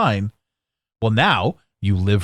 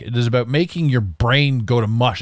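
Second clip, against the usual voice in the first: normal talking voice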